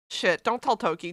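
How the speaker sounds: normal talking voice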